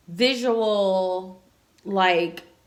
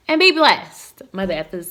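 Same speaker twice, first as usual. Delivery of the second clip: loud sign-off; 10 dB louder than usual. Real, not acted. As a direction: shout the goodbye with a grin.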